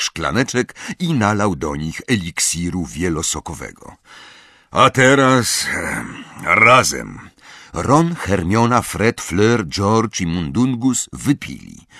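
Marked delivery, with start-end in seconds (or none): 4.7-7.2 loud, punchy cue with a pause; commanding leader. A narrator's reading. Moody cues the others to drink, commanding. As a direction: give the group its cue, loudly.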